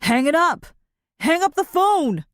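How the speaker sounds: high, loud shout. A narrator's reading. Thief shouting at the boy on the phone, high and loud. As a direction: bark the order at the boy. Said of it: "the taller boy shouting".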